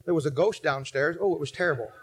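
low, soft, unimpressed mock of her line; a deadpan send-up of a bad actress; mocking the heroine. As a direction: flatly parody the actress's fright. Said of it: "she'd walk in and say".

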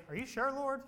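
high, hesitant mock query; doubtful questioning. Believer told to witness, doubtfully questioning God. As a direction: pitch it up, unsure of the order.

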